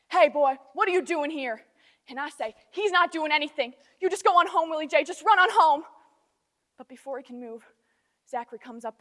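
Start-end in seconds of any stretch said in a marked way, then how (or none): 0.1-1.7 loud, confrontational demand; angry man confronting an intruder; angry challenge. Acted. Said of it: "he says to Willie J". 2.7-5.8 loud, building to a high shout; frantic, raised-voice protective plea; urgent protest. Acted. Urging boy away from angry man.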